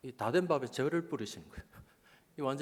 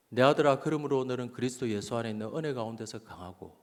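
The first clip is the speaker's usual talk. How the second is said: scripture reading; 4 semitones lower than usual. Retold or imitated. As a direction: read the verse aloud, falling lower after the call. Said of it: "그때 읽었던 말씀이". (성경 봉독하듯 또박또박)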